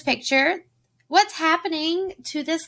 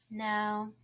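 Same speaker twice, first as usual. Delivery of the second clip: normal talking voice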